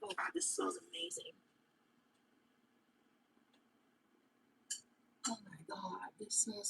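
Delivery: breathy hushed whisper over the gift. Real, not acted. Smelling a gift from a viewer, whispering in awe. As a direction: whisper it in awe, barely voiced.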